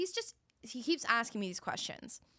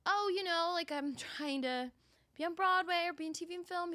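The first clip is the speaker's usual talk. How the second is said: retold in a higher, stretched voice; airy self-description; 9 semitones higher than usual. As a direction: imitate making light of big ambitions. Retelling her answer about her ambitions.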